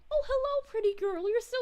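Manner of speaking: high, loud, delighted hello; light up and call out to a friend; excited greeting. Real, not acted. Greeting a viewer who arrived.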